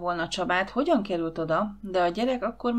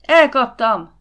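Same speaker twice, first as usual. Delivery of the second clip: loud, raised shout of triumph; bellowed; 5 semitones higher, 12 dB louder, slower than usual. A narrator's reading. Ákos announcing his catch to the household, bellowing. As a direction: roar it out well above talking level. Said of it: "ordított Ákos".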